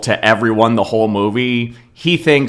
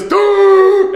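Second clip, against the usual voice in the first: very high, loud hammed-up "Doo!" gasp; a sudden holy gasp as he lifts off; mock rapture gasp; 6 dB louder than usual. Retelling the dying man's last gasp, hammed-up and loud. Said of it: "he gives a rapture gasp".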